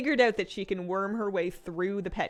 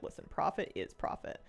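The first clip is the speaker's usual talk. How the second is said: hushed shrug; 4 semitones lower, 7 dB quieter than usual. Retold or imitated. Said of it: "I'm kinda like".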